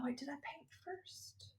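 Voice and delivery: a hushed unsure aside mid-story; murmured question to yourself; whispered aside. Real, not acted. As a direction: whisper to yourself, checking memory. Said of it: "whispered aside to self".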